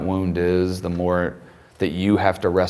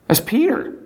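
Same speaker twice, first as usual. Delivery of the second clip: amused exclamation; 13 semitones higher than usual. Real, not acted. Preacher's punchline about Peter.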